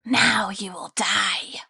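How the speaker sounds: slow sinister creature whisper; menacing whisper. Acted. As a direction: whisper a slow death threat. Grain spirit threatening.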